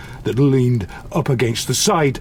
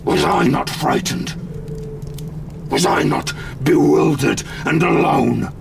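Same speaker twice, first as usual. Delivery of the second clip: anguished pleading; 4 semitones higher than usual. Acted. Pleads his own fear.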